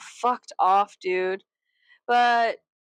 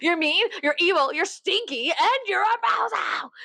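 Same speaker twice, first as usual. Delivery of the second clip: mock name-calling; 8 semitones higher than usual. Mimicking an old lady insulting everyone, mock childish tirade. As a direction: taunt like a kid, higher and higher. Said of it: "No, she didn't say that".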